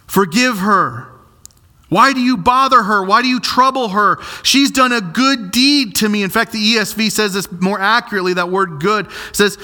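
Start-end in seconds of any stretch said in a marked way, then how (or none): 0.1-6.2 raised, firm "Why do you bother her"; Jesus rebuking. Acted. Jesus rebuking the disciples, raised and firm. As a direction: scold the disciples firmly but kindly. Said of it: "Jesus says".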